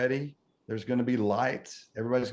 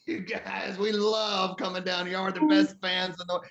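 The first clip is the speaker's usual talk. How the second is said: Steven addressing crowd; 8 semitones higher than usual. Retold or imitated. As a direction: call out to the whole plane, grand and happy. Steven waves to passengers.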